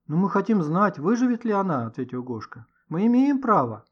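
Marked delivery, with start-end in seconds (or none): 0.1-1.9 put-on higher, louder boyish objection; indignant and pressing; insistent protest. A narrator's reading. Boy protests being sent away. 2.9-3.8 high, loud protest; defiant, raised voice; indignant insistence. A narrator's reading. Boy insists on his right.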